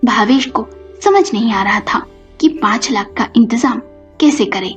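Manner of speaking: usual speaking voice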